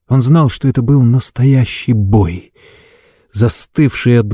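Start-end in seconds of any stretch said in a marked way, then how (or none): none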